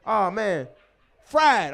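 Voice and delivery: ordinary delivery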